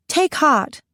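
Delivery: put-on high, regal British accent; quick British accent. A narrator's reading. Queen suddenly remembering her next line, British accent. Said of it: "I say quickly".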